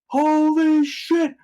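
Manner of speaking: very high, loud and slow gasp of amazement; amazed. Retold or imitated. Old man realizes who the newcomer is, amazed and slow. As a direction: stretch it out, floored. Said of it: "the guy turns around and he goes".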